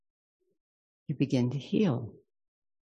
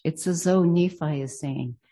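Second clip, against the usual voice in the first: usual speaking voice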